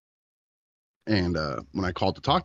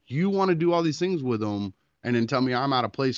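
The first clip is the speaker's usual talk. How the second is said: retold heated rant; 8 semitones higher than usual. Father scolding his ex-wife on the phone, heated retold rant. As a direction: lay into her, voice pushed up hard. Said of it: "I laid into her".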